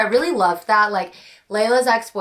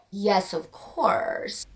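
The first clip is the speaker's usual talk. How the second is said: slow, softer knowing yes; slow sly admission; 5 dB quieter, much slower than usual. Real, not acted. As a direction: drawl it like a guilty confession. Answering own smut question.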